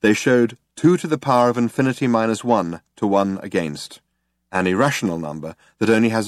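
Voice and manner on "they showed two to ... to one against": ordinary delivery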